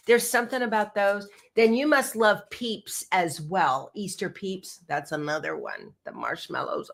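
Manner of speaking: usual speaking voice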